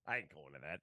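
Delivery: mock gruff refusal from partner, low and quiet; imitating partner. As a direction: drop low and grumble it like a stubborn partner. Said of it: "That's what he would say".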